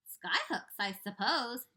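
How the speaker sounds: jeering. Acted. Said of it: "jeered the centipede".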